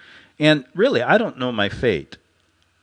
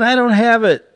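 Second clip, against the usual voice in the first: "I don't have it" cried out higher and louder; protesting; 12 semitones higher, 7 dB louder than usual. Retold or imitated. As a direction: insist loudly, pitched up, like a wronged man. Protesting to captors, quoted.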